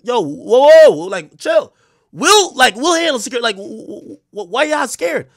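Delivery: a loud, rushed plea for them to calm down; hammed-up urgent retelling of talking the venue down. Retold pleading with venue on phone.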